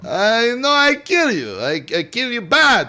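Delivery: high, mock French accent in broken English. Shown as a demonstration. Retrying after being corrected, comic French accent. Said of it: "I said French accent, not French".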